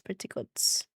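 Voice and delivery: low hushed echo; muttered echo. Real, not acted. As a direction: echo it to yourself.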